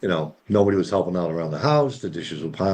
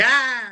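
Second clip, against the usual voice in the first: high, drawn-out imitation scream; hammed-up frustrated "aah"; mock exasperated scream. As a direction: mimic a wife giving up in frustration. Imitates wife's frustrated yell again.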